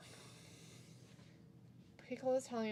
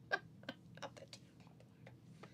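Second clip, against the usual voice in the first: whispering; 11 dB quieter, much slower than usual. Real, not acted. Laughing whispered reply.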